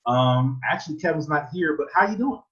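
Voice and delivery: put-on high female voice; flirting mom. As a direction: imitate a mom turning flirty at the end. Voicing friend's flirting mother.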